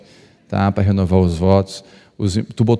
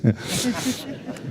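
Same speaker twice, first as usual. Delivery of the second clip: short high burst of laughter; quick amused laugh; 13 semitones higher, 10 dB quieter than usual. Real, not acted. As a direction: laugh at his own joke. Laughs at joke mid-sermon.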